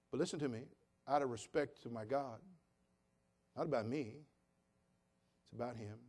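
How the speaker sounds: normal talking voice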